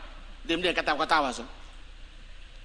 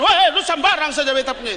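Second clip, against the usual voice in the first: high, loud indignant shout; half-laughing mock indignation; loud indignant anger; 8 semitones higher, 8 dB louder than usual. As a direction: protest someone messing with your things. Acted justified anger, loud.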